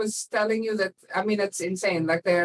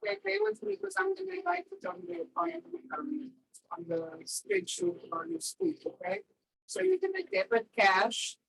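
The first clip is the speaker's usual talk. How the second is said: muffled mic; 11 semitones higher, 6 dB quieter than usual. Real, not acted. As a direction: voice higher and far softer, muffled. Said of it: "your mic sounds, like, muffled".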